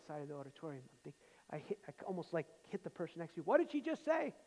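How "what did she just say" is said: higher and louder, stunned disbelief; astonished. Retold or imitated. A young listener at a conference, stunned by the preacher, astonished. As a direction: blurt it out, stunned by what you just heard. Stunned reaction to the speaker's words.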